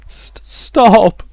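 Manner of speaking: stammered, high, loud plea; desperate cry. A narrator's reading. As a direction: break into a shout to make it stop. Rosa begs the voice to stop.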